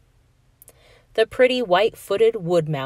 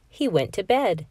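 usual speaking voice

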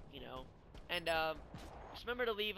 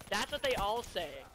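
higher, louder, slow and knowing; mock-wise retold kid, raised and slowed; imitating a smug kid; 5 semitones higher, 7 dB louder, slower than usual. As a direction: drag it out like a know-it-all kid. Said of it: "He looks at me and goes".

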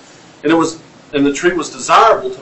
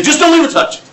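high, loud mock warning of a cautious parent; emphatic warning; 12 semitones higher, 4 dB louder, faster than usual. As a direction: warn them off, half joking. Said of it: "I say".

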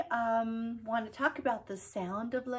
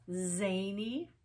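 normal talking voice

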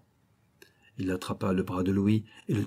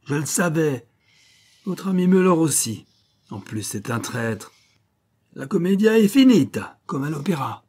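much higher, louder theatrical flourish; triumphant; 7 semitones higher, 6 dB louder than usual. Acted. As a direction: announce it like an opera finale. Schiller's triumphant verdict.

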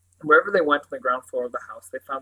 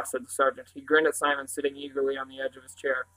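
ordinary delivery

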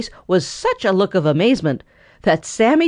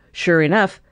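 plain reading voice

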